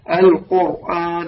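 slow "القرآن" with a thick ra after "qu"; heavy ra. Shown as a demonstration. Teacher models a Quranic word for students, heavy ra. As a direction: draw out "القرآن", ra full and heavy. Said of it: "هكذا".

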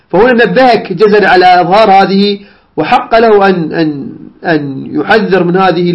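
normal talking voice

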